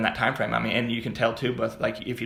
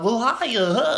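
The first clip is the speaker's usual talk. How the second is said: awkward reply; 10 semitones higher, 4 dB louder, much slower than usual. Retold or imitated. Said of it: "Oh, that was awkward. Why did I say it like that?".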